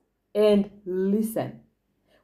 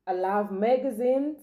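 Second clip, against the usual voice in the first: usual speaking voice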